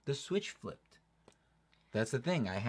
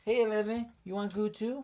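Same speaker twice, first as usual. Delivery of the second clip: pet talk; 12 semitones higher than usual. Real, not acted. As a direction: coo it up high to the pup. Baby talk to dogs.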